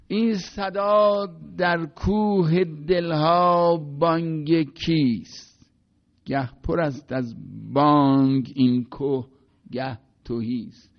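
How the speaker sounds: recited Persian poetry, slow and sonorous; poem recitation. Shown as a demonstration. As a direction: chant the verse slowly, letting each word ring. Recites Masnavi verse aloud.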